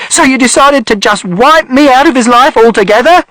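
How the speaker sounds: high, loud, outraged shout; hurling the accusation at him; mother shouting. A narrator's reading. Mother shouting at father for erasing her, outraged. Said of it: "And mother shouted".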